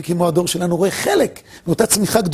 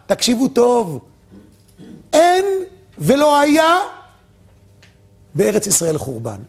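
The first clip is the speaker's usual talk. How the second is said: emphatic declaration; 4 semitones higher than usual. Retold or imitated. Akiva declares to sages.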